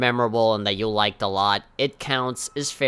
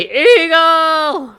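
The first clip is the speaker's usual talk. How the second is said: last word screeched high, loud and long; eagle shout; 10 dB louder than usual. Pretend. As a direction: announce it, exploding into an eagle cry. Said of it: "I was just gonna shout".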